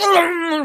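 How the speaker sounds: high, loud machine-gun noise; imitated rapid fire. Troopers shooting, imitated rapid fire. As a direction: rattle out mouth-made gunshots. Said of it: "rapid fire".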